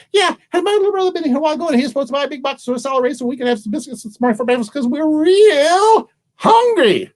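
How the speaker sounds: put-on high, breakneck little-girl chatter; eager little girl. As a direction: race through it high, then wail the end.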